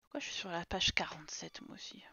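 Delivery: unvoiced whisper to herself. Real, not acted. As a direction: whisper it under the breath. Whispered self-question.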